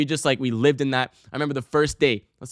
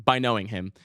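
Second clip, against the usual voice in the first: normal talking voice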